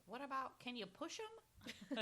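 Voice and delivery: put-on high, slow cutesy baby voice; little baby voice. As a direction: ask it like an innocent toddler. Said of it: "the little babies goes like".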